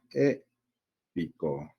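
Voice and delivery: "Épico" drawn out slowly in separate pieces; slow teacher's breakdown of "Épico"; syllable by syllable. Shown as a demonstration. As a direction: break "Épico" apart to show its stressed syllable. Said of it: "Cada una tiene su propia sílaba tónica".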